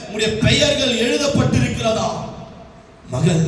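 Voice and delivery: normal talking voice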